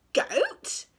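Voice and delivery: very high rising "Goat?"; a pirate appalled by the idea; shocked. A narrator's reading. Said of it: "said Hank Chief".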